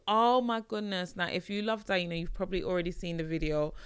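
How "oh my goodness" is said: ordinary talk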